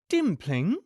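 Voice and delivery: Dimpling asked with a rising questioning tune; puzzled question. A narrator's reading. Missus Dearly echoes the place name, rising puzzled question. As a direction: ask it back, puzzled.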